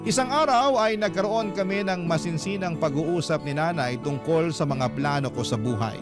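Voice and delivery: normal talking voice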